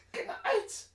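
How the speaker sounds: whispered, trailing kijk nou uit; hushed repeated warning, fading out; fading catchphrase. Acted. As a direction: let it die away into a whisper.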